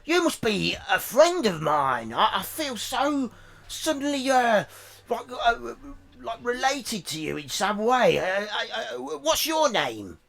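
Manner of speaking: high, shouting, insolent goblin; rude goblin voice. Acted. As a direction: squawk it out like a rude little goblin. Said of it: "and it's like".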